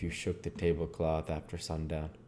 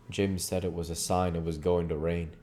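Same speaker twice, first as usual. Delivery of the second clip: plain reading voice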